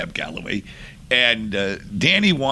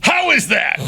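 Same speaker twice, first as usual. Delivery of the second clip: loud, very high mock-angry demand; angry challenge; 5 dB louder than usual. Mad singer finishes the retake, acted angry demand. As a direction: fire it out as a hammed-up challenge. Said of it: "He's so mad".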